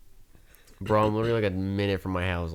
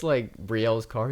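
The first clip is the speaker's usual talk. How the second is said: ordinary talk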